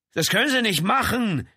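high, helpless protest; deflated plea. A narrator's reading. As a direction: plead with the anger gone. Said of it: "jeder Anflug von Zorn war ihm vergangen".

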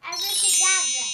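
shouted abracadabra; loud magic word. Pretend. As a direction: call out the spell loudly.

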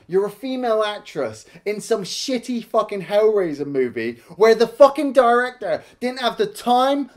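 normal talking voice

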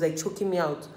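normal talking voice

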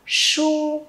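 "Su" high and held, retroflex SH; teacher's long, level retroflex "Su"; curled-tongue sound. Shown as a demonstration. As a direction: curl the tongue up and sustain "Su". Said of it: "cong lưỡi lên cho cô nào".

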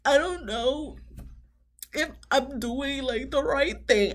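high, soft, drawn-out fake weeping; mock dramatic crying. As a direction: imitate an overdone tearful wail, haltingly. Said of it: "She was crying dramatically".

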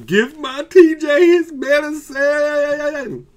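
high, loud, slow demanding yell; imagined demand. Retold or imitated. Owner at the front desk, demanding medicine, slow high yell. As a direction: bellow it like pounding on the front desk. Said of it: "start pounding and saying".

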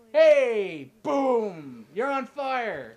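high, loud imitation of the game's attack; mock yelling. Player mimicking what happens every time, mock yelling. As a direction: call it out big and drawn-out. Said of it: "why is everyone yelling".

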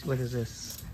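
ordinary delivery

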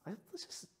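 hushed whispered wonder; soft unvoiced awe. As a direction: breathe it like a secret hope. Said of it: "just going".

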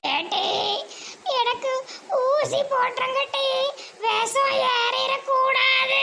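put-on high, slow, quavering old-woman voice; very high, slow granny complaint. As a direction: play a frail, whimpering grandma. Granny fears poison.

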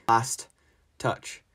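ordinary delivery

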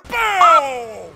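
loud, long falling "Boom!"; shouted boom. Pretend. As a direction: bellow it as an attack lands. Shouts as he attacks in game.

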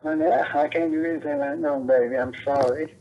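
ordinary talk, nothing marked

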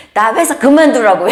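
mock hurt grumble; aggrieved complaint. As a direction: complain it as an accusation. Quoting the husband's complaint.

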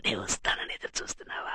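secretive, voiceless whisper; hushed, breath-only question. Acted. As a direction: whisper it so the house won't hear. Secret night meeting, whispered.

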